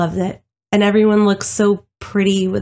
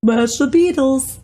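echoed Scottish rant; 6 semitones higher than usual. Pretend. Echoing the Scottish voice.

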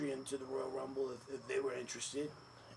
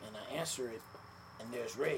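ordinary talk, nothing marked

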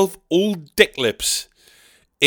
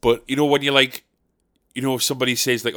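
usual speaking voice

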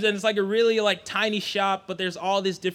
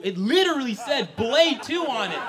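ordinary talk

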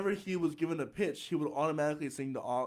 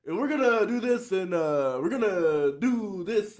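Obama; 5 semitones higher, 8 dB louder than usual. Acted. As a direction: imitate a politician stretching each phrase, pitched up and loud. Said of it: "was his cadence".

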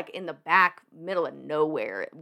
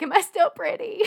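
much higher pleading question on the edge of a laugh; mock plaintive question; 12 semitones higher, 3 dB louder than usual. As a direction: ask it like a worried teen, half laughing. Asking nurses, laughing.

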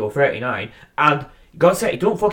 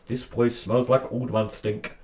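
Bret Hart voice; 2 semitones lower, 7 dB quieter, slower than usual. Retold or imitated. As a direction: say it flat like Bret. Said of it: "Bret Hart is more".

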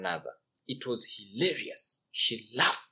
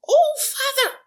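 very high, breathless with laughter; laughing princess; 12 dB louder than usual. Acted. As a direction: gasp through giggles. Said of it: "gasping for air from laughter".

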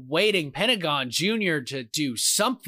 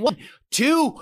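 high, eager pin count; excited count; 7 semitones higher, 3 dB louder than usual. Real, not acted. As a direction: count the pin on the edge of your seat. Counting the pinfall.

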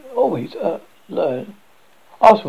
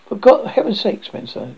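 surprised; 7 semitones higher, 4 dB louder than usual. A narrator's reading. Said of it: "they said, in surprise".

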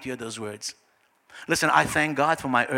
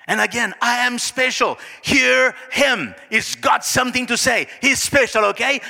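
high, loud, insistent heavenly endorsement; forceful preaching in God's voice; emphatic proclamation; 8 semitones higher, 5 dB louder than usual. Acted. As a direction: insist that everyone take notice. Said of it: "What did Jesus hear?".

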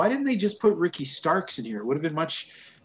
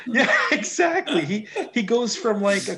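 laughing; 8 semitones higher, 4 dB louder than usual. Real, not acted. Laughing after the bit.

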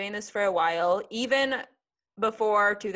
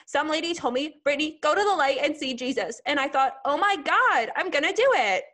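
higher, wide-eyed mock-earnest sarcasm; 8 semitones higher, 5 dB louder than usual. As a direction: play it breathlessly awestruck, tongue in cheek. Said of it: "that's my impression of the infamous".

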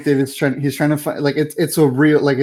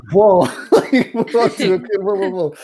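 mock startled whoa; 5 semitones higher than usual. Doctor reacts to shocking news.

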